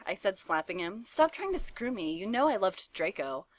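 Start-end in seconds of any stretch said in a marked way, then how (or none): none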